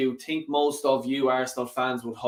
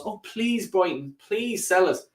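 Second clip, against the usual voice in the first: higher, hammed-up begging; mock pleading; 4 semitones higher than usual. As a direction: beg sarcastically, like a grovelling buyer. Mock begging Brighton to sell.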